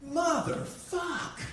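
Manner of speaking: high, slow drawn-out dismay; stunned curse. Retold or imitated. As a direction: let the curse sink slowly. Shocked discovery of own ADHD.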